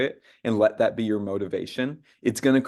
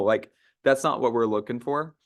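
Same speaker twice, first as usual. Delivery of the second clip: usual speaking voice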